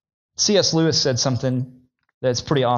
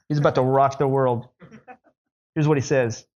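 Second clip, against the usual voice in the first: normal talking voice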